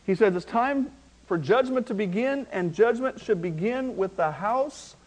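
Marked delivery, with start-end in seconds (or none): none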